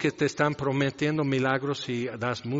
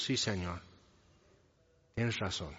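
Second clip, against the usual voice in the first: humble soft reply; 6 semitones lower, 10 dB quieter than usual. Acted. Woman humbly accepting Jesus' word.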